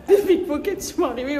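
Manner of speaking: words through a loud laugh. Real, not acted. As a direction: laugh while getting the words out. Laughing at own joke.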